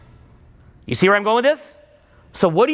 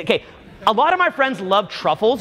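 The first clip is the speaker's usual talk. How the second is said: ordinary talk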